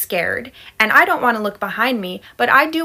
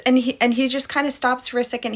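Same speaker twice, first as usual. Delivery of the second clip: ordinary delivery, nothing marked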